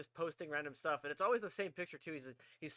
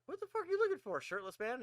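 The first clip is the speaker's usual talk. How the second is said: louder, higher retold demand; playful incredulous challenge; mock exasperation; 11 semitones higher, 5 dB louder than usual. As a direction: act out calling him out, pitched up. Retold challenge to a rummaging friend.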